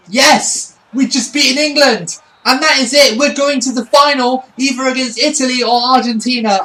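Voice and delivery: loud, high victory shout; ecstatic cheer. Real, not acted. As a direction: celebrate the win at full voice. Winning the semi-final.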